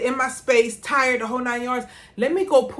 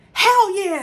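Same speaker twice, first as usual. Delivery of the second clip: excited outburst; 9 semitones higher, 7 dB louder than usual. Real, not acted. Fired-up rebuttal to defeatism.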